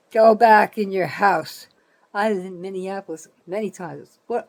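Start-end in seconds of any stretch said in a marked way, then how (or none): 0.1-1.7 loud, slow go back in your house; scolding command. Acted. Vlogger orders Minneapolis protesters home, loud and slow. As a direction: bark it at them like an order.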